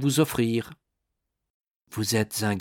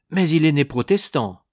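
indignant objection; 6 semitones higher, 6 dB louder than usual. A narrator's reading. Minister disputes dying man with monk.

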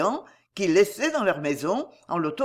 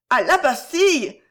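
higher, louder exclamation of dismay; alarmed cry; 7 semitones higher, 6 dB louder than usual. A narrator's reading. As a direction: cry out in shocked protest for two frightened women. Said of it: "S'écrièrent en même temps Violetta et Gisèle".

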